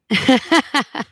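short breathy nervous laugh. Real, not acted. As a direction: laugh it off, uneasy. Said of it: "It's a, it's a real nervous laugh now".

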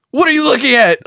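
high, loud challenge; hammed-up angry shout. Joseph at the airport confronting onlookers, mock angry yell. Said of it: "same shit in the airport".

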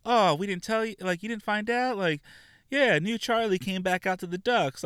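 high, sing-song mock of a snobby teammate; catty fake-sweet teen voice; snotty mean girl. Mocking the snooty teammate Stephanie.

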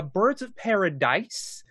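"Paradise" with a drawn-out, emphasized final "dise"; slow "Birds of Paradise", leaning on the last syllable; stress on last syllable. Real, not acted. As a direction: land hard on the "dise" in "Paradise". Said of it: "I will always emphasize that last syllable".

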